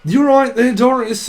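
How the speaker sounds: loud, high, cheery pub voice; chirpy pub regular. Acted. Pub drinker greeting Doris, loud and high and cheery. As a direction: sing it out like a regular at the bar.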